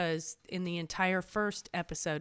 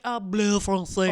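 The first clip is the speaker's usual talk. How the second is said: mock French accent; 3 semitones higher, 10 dB louder than usual. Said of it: "Jamie just being like".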